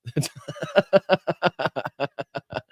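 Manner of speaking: long, rapid-fire chuckle; amused run of laughter; real laugh. Real, not acted. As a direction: laugh helplessly at a joke. Laughing at a viewer's comment.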